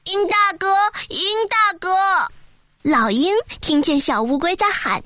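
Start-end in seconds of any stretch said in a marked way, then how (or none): 0.0-1.9 high-pitched call up to the sky. A narrator's reading. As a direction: hail someone flying overhead. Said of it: "他向天空大声喊起来".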